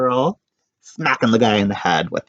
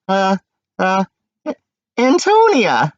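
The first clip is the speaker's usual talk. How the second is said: put-on high nervous girl; 9 semitones higher, 5 dB louder than usual. Nervous girl stammers her name.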